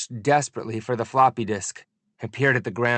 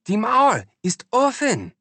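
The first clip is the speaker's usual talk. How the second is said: high, loud German exclamation; jubilant German shout; 11 semitones higher, 4 dB louder than usual. A narrator's reading. Grinning woman shouting the news at the boy. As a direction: cry it out in German with joy. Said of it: "she yelled after me".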